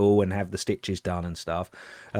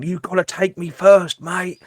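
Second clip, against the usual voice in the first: higher, taunting suspect voice; defiant suspect; 8 semitones higher, 4 dB louder than usual. Retold or imitated. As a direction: dare the copper to take him. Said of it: "he's going".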